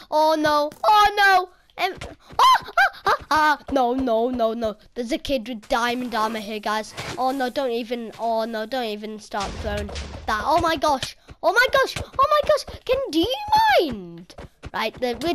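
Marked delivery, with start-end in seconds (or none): 0.1-4.8 loud, high panicked cries. Real, not acted. Player sees an armored kid coming, panicked. 10.5-14.3 loud, climbing higher each time; rising frantic cries. Real, not acted. Player dreading the diamond armor kid, frantic.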